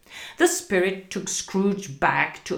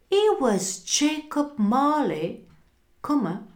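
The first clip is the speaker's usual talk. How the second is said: ordinary delivery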